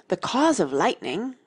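confident pupil reciting an answer; very decided. A narrator's reading. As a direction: answer with complete certainty. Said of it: "Alice said very decidedly".

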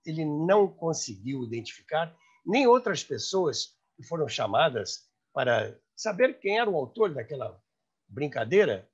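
ordinary delivery

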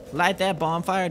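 normal talking voice

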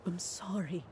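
a soft, breathy whisper of remorse; guilty aunt whispering sorry to the kids; whispered apology. Acted. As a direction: barely whisper it, ashamed. Aunt apologizes after slip.

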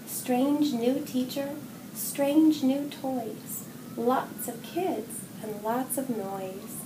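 usual speaking voice

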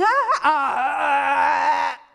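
slow, loud, cracked cries; the bird tries to sing but only croaks; strained, broken caws; croaking bird cries. Acted. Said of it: "His voice ... had been lost".

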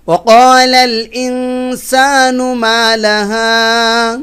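slow, melodic chanted recitation; tajweed-style chant; Quranic recitation. Shown as a demonstration. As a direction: recite slowly with a drawn-out tune. Recites verses.

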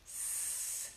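"S-" as a long soft hiss without voice; sustained S sound; pure S. Shown as a demonstration. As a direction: hold a bare "sss", no vowel before it. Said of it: "empezar estas palabras con la S".

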